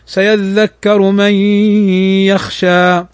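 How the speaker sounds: من يخشى with the نون merged into the ي and nasalised; slow recitation of من يخشى with ghunna; idgham with ghunna. Shown as a demonstration. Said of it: "فيقرأها هكذا".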